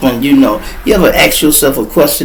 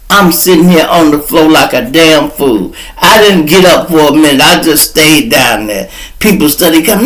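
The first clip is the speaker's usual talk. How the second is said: usual speaking voice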